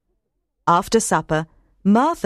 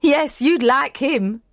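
higher, fond maid; a touch of sisterly pride; lightly voiced Martha; 6 semitones higher than usual. A narrator's reading. Martha praising Dickon.

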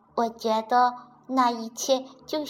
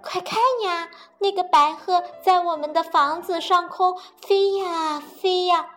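higher and louder excited bunny; excited pointing; 8 semitones higher, 7 dB louder than usual. Acted. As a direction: point at the sky, thrilled. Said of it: "蹦蹦说".

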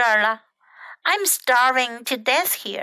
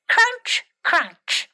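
demonstrated crunching; 7 semitones higher than usual. Acted. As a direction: show how to munch a carrot. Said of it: "like this".

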